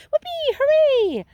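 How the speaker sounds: shrieking high, loud whoops of joy; cheering. A narrator's reading. Violet cheers about free gum for life, shrieking high. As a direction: squeal it out like a thrilled brat.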